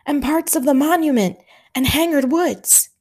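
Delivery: a hint of a tense Highland officer; Scottish officer Hamish. A narrator's reading. Hamish briefing Stanley under shellfire, lightly voiced.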